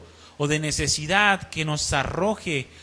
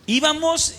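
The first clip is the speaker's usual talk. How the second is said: exclamación; 10 semitones higher, 5 dB louder, slower than usual. Real, not acted. Ánimo al narrar.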